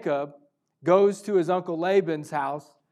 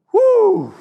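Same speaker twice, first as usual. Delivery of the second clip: high exhale; 11 dB louder than usual. Retold or imitated. Overwhelmed at God's presence.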